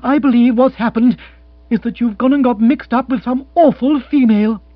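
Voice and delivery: fiancée in full reproach; accusation gathering momentum. Acted. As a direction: build up steam and accuse him. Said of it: "gathering momentum in her turn".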